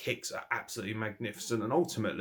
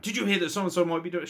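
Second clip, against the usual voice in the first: mocking spoiler gossip; 9 semitones higher, 4 dB louder, faster than usual.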